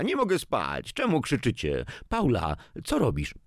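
put-on high-pitched sleepy child's complaint; little girl. A narrator's reading. Granddaughter woken by the adults' quarrel, childlike complaint. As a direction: voice a small girl asking what's going on.

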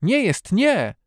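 high, loud protest; outraged girl shouting back; indignant cry. A narrator's reading. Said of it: "krzycząc z oburzeniem".

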